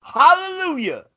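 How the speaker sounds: high, loud triumphant hallelujah. Acted. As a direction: cheer it out in relief. Mock relief at silence.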